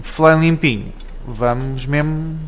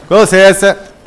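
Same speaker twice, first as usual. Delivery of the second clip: Com licença! called out louder and higher; loud excuse me; 5 semitones higher, 7 dB louder than usual. Acted. Gamer calling out to the ladies to clear the way.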